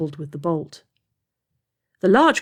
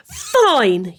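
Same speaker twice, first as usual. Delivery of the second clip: a high, loud angry yell; girl yelling at her brother; 8 semitones higher, 4 dB louder than usual. A narrator's reading. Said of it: "yelled the girl".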